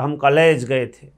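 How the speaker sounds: put-on UP Bihar accent. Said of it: "UP Bihar वालों से आप बात करो".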